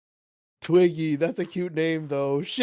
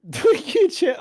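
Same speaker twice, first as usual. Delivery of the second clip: laughing; 12 semitones higher, 3 dB louder than usual. Real, not acted. Laughing disbelief.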